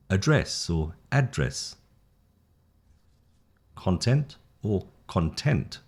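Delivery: each word said twice, first stress then last stress, slow; slow contrastive drill of noun and verb stress; stress contrast pairs. Shown as a demonstration. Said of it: "the noun, the first syllable, the verb is the second syllable".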